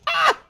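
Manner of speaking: short, very high yelp; sharp shriek. Real, not acted. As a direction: yelp high when hit. Getting shot.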